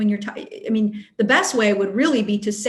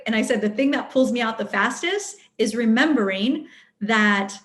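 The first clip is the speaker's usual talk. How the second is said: normal talking voice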